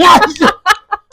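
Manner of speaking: high, loud burst of laughter. Real, not acted. Laughing at interview.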